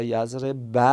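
یضرب ب ending in an open "ba"; final a pronounced. Shown as a demonstration. Teacher demonstrating another case ending, final a pronounced. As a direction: finish یضرب on a clear short a, "yazreba". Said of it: "مثلاً اینطوری".

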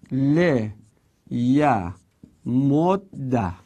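ل ی مد ده syllable by syllable, le-ya-mod-da; merged form. Shown as a demonstration. Teacher spells out the result for students, merged form. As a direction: slowly separate ل ی مد ده, doubling the d. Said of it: "اون وقت این بشه".

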